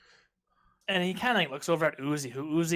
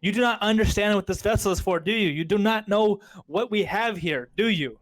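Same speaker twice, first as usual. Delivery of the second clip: put-on higher, louder accented rant at the hacker; mock yell at the hacker in a foreign accent; 5 semitones higher, 3 dB louder than usual. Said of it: "yelling at Uzi again".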